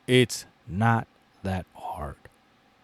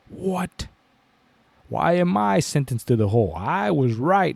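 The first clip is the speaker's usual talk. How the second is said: higher-pitched mock protest; indignant tiger; 6 semitones higher than usual. Sentenced tiger protests to the lion, indignant and higher.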